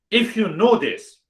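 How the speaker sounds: pitched high and pressing. Real, not acted. Teacher challenging the audience, high and pressing. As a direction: lift the voice to confront them.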